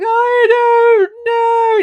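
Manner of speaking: high, loud mock-crying wail; wailing sob. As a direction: drag it out in a loud, hammed-up sob. Acts out sobbing reply to husband.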